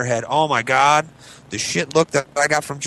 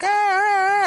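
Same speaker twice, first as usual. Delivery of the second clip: put-on high, whiny kid protest; mock toddler whine; whining child; slower than usual. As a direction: whine like a toddler denied a toy. Child protesting wagon taken.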